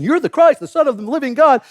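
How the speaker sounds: excited confession. Retold or imitated. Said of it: "Peter had this great revelation".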